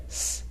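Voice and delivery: "s" as an isolated hiss; soft C sound. Shown as a demonstration. Teacher leading into cereal, hissed "s". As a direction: hiss "s" before the word. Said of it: "in two different sounds".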